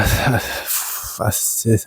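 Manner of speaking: ordinary talk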